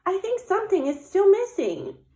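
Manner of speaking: high, sing-song little bear voice; Little Bear pondering. Acted. Little Bear studying her snowman, high pondering voice. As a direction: ponder aloud like a small storybook bear.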